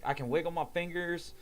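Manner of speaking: reenacting an exasperated plea to be moved; urgent insistence. Injured man to rescuers while lying in ants, urgent insistence.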